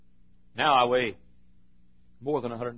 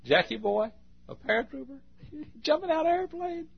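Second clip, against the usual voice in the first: high, laughing mock of scoffers; imitating laughing mockers; mocking townsfolk. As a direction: ridicule the small boy's dream. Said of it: "folks laughed at me".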